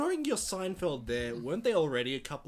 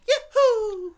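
high, loud cartoon whoop; high-pitched Mario yahoo; 12 dB louder than usual. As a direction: shout it like the jumping plumber. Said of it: "Mario's more".

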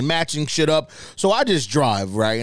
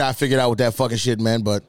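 ordinary talk

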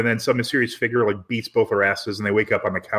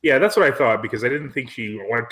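ordinary delivery

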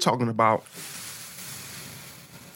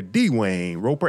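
usual speaking voice

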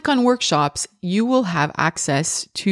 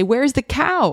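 put-on high sing-song question to a toddler; parentese question; 7 semitones higher than usual. Mom asking her toddler to find the cow, high sing-song. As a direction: ask playfully like a hide-and-seek game.

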